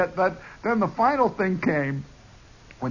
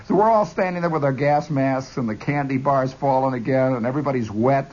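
usual speaking voice

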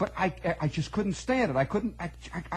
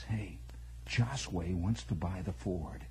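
soft, slow conspiratorial whisper; 9 semitones lower, 8 dB quieter, slower than usual. Retold or imitated. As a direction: lean in and whisper the news. Secret deal whispered in study hall.

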